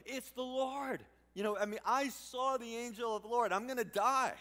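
loud, high, panicked voicing of Gideon; high and loud, afraid for his life; panicked cry. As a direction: cry out that you're about to die.

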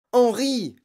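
high, loud cry of fright; alarmed cry. A narrator's reading. Frightened mother calling her husband, crying out. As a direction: call out in sudden alarm. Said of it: "s'écria la mère, devenant toute blanche".

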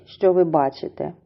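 usual speaking voice

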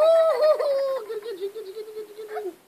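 high, laughing playful chant; Tickle Monster. Acted. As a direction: pounce on him, giggling.

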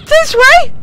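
high, loud call; urgent call. Real, not acted. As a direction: call the plane over. Directing plane, rising frustration.

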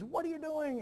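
hammed-up yell of the outraged father; retold scream. Teacher acting the father catching the girls: hammed-up scream. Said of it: "And he screams".

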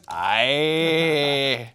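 long, drawn-out mock Scouse "Aye"; teasing Scouse imitation. Teasing co-host about talking to his parents, mock Scouse. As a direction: stretch it out, mimicking your co-host's Scouse. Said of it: "that's like when you talk to your parents".

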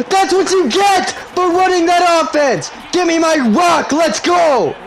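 slow, very high-pitched yell of triumph; triumphant taunting shout. Real, not acted. Gamer taunting rival after stopping his offense, triumphant shouting. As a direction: shout it in your opponent's face.

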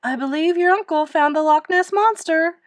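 high, loud revelation; excited call. A narrator's reading. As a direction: announce the astonishing discovery. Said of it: "Miss Severs called".

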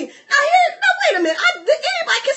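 high-pitched, incredulous protest; hammed-up high objection; mock exasperation. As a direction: break in with squeaky disbelief. Preacher acts out exasperated disbelief.

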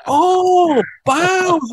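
the penny drops, hammed up; dawning realization. Young bassist realizing bows means the bow, high mock surprise. Sudden realization in retold gig.

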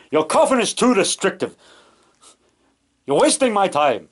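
lightly voiced peevish film vampire actor; Bela Lugosi. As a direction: complain in a hint of an old horror star's accent. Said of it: "where Bela Lugosi was getting his coffin".

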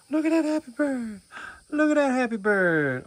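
thrilled at the happy bird; delighted gasp. Real, not acted.

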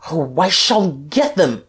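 slow, strained outburst; frustrated, drawn-out cry; howling. A narrator's reading. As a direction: howl it out, beside yourself. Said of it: "howled Guerchard".

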